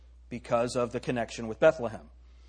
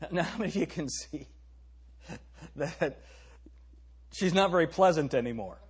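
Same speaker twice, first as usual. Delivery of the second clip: laughing; 4 dB quieter than usual. Real, not acted.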